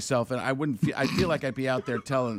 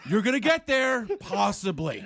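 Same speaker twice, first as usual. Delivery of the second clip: lightly mock motivational speaker, starting high; a hint of pep-talk, dropping away at the end; 5 semitones higher than usual. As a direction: build them up, then deflate. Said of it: "motivational speaking Frank".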